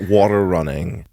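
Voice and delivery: ordinary talk, nothing marked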